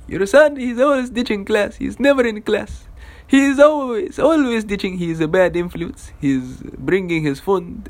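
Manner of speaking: high, put-on complaining teacher voice; hammed-up high-pitched telling on a student; tattling teacher. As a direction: list a pupil's crimes in mock outrage. Teacher tattles on him to dad.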